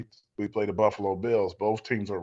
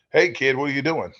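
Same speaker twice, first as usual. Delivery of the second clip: higher, louder coach's phone greeting; Coach Parcells; 5 semitones higher, 6 dB louder than usual. Retold or imitated. Coach phoning a player at home, loud imitated greeting. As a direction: hail the kid brusquely, pitched up. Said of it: "Who's this guy with this weird accent?".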